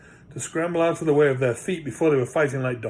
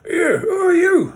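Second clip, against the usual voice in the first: very high, loud, drawn-out squeak; a startled magic purse squeaking out; 9 dB louder than usual. Acted. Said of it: "it squeaked".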